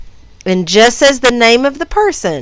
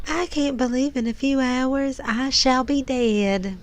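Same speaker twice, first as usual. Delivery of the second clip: put-on slow, soft Southern accent on the movie line, a touch higher; 5 semitones higher, 8 dB quieter than usual. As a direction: drawl it out Southern, quietly and slowly. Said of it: "Southern accent".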